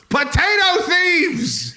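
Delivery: high, long-drawn hollered alarm; calling out. Acted. Potato farmer raises the alarm over thieves, calling out. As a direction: holler it slowly through cupped hands. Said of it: "I cupped my hands and I called out".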